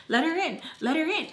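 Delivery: high, repeated urging; retold insistent demand. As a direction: goad the friend holding the door. Retold demand to open door.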